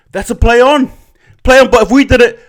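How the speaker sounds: high-pitched, loud disbelief at the call; indignant. Real, not acted. Fan protesting an unfair call, indignant. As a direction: protest the double standard, voice jumping up. Indignant at double standard in calls.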